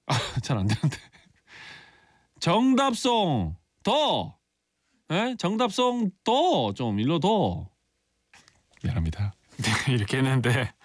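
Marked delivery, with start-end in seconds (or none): 2.4-7.7 much higher, slow Busan dialect plea; 높고 느린 부산 사투리. Shown as a demonstration. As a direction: stretch it out in thick Busan dialect. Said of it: "다시 한번 부산 사투리로 힌트 한번".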